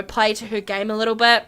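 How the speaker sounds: usual speaking voice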